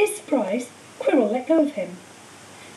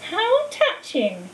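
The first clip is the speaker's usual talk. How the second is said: slow, higher, mocking hiss; 7 semitones higher, much slower than usual. A narrator's reading. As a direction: sneer it with cold contempt. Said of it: "it hissed".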